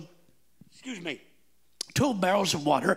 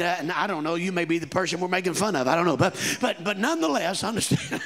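ordinary talk

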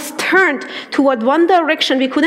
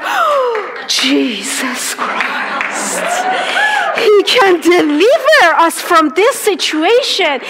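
excited exclaiming; 6 semitones higher, slower than usual. Retold or imitated. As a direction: proclaim it like a revelation. Retells joyful discovery with friend.

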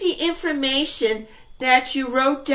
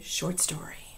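a whispered "Story"; 9 semitones lower, 14 dB quieter than usual. Real, not acted. Teacher whispering the book title's final word. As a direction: drop to a whisper on the last word. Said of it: "whispered end of title".

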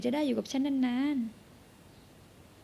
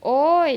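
sudden loud high ouch; pained cry; 4 semitones higher, 11 dB louder, much slower than usual. Acted. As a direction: yelp in pain. Grabbed during struggle. (ร้องโอ๊ยเสียงดังด้วยความเจ็บ)

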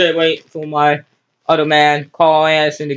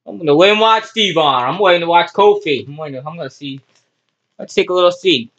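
ordinary delivery

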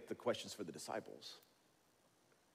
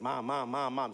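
put-on insistent child calling Mom, a bit louder; nagging kids; 2 semitones higher, 7 dB louder than usual. Preacher imitates kids pestering their mom, insistent calling. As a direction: pester like a whiny kid.

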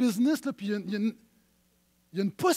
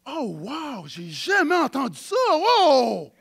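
mock amazement; 4 semitones higher, 8 dB louder, slower than usual.

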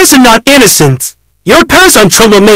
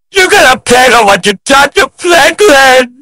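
enraged guard yelling down the cells; angry shout; 4 semitones lower, slower than usual. Acted. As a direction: bark the accusation and gloat over their fate. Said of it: "I guess that man is very mad".

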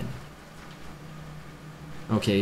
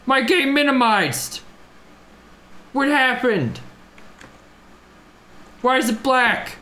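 shocked, higher, louder cries; alarmed; 11 semitones higher, 3 dB louder, slower than usual. Real, not acted. Game suddenly minimized.

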